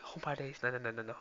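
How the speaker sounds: normal talking voice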